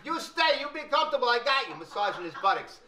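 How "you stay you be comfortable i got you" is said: mock Chinese masseur. Masseur soothing Big Fat in a hammed-up Chinese accent. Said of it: "tell him".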